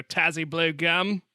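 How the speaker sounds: slow, drawn-out Aussie twang; mock Australian accent. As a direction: stretch the vowels in a playful Australian accent. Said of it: "a hard thing not to say with an Australian accent".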